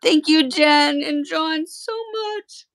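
choked-up thanks to the makers of the gift; tearful thanks. Real, not acted. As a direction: thank them through tears. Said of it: "I'm gonna start crying".

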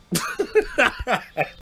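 high breathy laugh. Real, not acted. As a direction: laugh at the image. Laughs at own line.

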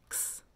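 "X" as a short soft "ks"; quick sound. Shown as a demonstration. Teacher confirming it was fast, "X" as quick "ks". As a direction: make "X" one brief unvoiced burst. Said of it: "That was fast".

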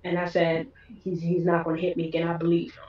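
very soft, low voice; small, low-toned confession; subdued recollection. Real, not acted. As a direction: quietly recall convincing yourself, low and hushed. Recalling her hopeful self-persuasion.

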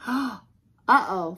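loud, drawn-out alarm; worried uh-oh. Acted. Dog thinks she's in trouble, worried. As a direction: dread what is coming next. Dog fears the burglar's pot.

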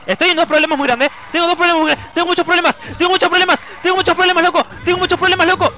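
high, loud, frantic repetition; panicked shouting. Real, not acted. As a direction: keep yelling it as trouble piles up. Trouble mounting in the game. (pánico a los gritos)

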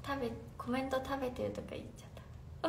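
fast, breathless whisper to herself; embarrassed whisper. Real, not acted. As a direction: whisper the slip away embarrassed. (恥ずかしそうに早口でささやく)

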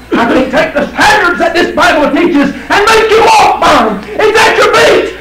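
high shouted preaching. Acted. Preacher shouting to the congregation, fervent shout. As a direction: shout it out at full force.

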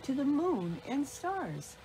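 mock dismay, swooping high; dismayed little pup. A narrator's reading. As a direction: lament it like a small child at bedtime. Pup remembers a forgotten goodnight.

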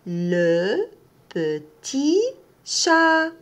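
ordinary talk

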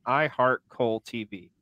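slow, spelled-out I heart Cole T V; slow catchphrase. Shown as a demonstration. Host showing viewers the comment, slow and clear. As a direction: say it slowly for viewers to copy. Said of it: "Just say".

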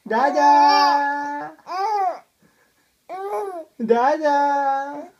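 long held vowel, called out; calling for Dada. Shown as a demonstration. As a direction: show the baby how to call dad. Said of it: "Come on, call Dada".

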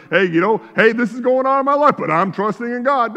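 parodying someone announcing their faith; mock broadcasting believer. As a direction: tell everyone, lightly mocked. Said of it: "They wanna tell everybody".

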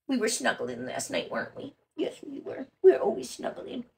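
whispery cooing; soft dog talk. Real, not acted. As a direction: murmur tenderly to the puppy. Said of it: "I talk dog to my dog".

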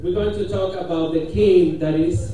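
usual speaking voice

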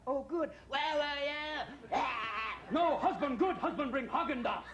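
high, shouting pregnant wife; moody pregnant wife. Acted. Hormonal wife demanding ice cream from husband, high yelling. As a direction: swing from sweet to yelling, high.

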